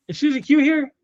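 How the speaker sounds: high, excited surprise; surprised question. Real, not acted. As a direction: perk up at the news. Surprised a chatter arrived.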